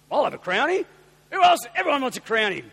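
loud cheerful call. Retold or imitated. Voices calling back in the room.

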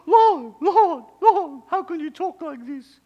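very high, loud mock Spanish-accented protest. Johnny aghast at the Lord's language, shocked Spanish accent. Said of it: "talking with his Spanish accent".

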